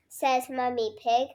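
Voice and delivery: usual speaking voice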